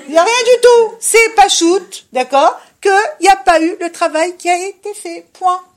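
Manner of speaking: loud and high, hammering the point; forceful insistence. Real, not acted. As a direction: shout it down, no excuses. (martèle fort et haut, sans appel)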